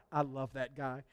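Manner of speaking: low, soft, affectionate aside; fond confiding. Retold or imitated. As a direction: marvel fondly, almost to yourself. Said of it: "And he just was like".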